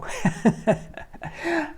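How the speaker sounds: high burst of laughter; laughs. Real, not acted. Speaker laughing after getting carried away. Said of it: "I hope that get carried away".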